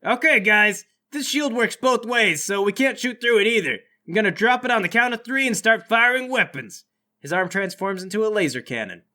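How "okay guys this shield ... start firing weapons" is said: higher-pitched voice rallying the team; Bart. Acted. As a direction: call the plan out, pitched up. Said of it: "Bart says".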